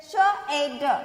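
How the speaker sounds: श ए ड sounded out separately; letter sounds. Shown as a demonstration. Teacher showing children Hindi sounds, श ए ड said sound by sound. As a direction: break the word into three sounds, श ए ड. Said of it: "कैसे बोलेंगे हिंदी में".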